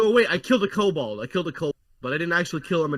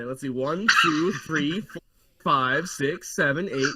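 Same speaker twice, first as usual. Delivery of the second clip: ordinary delivery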